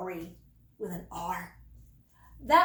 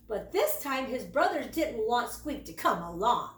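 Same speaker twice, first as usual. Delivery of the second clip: plain reading voice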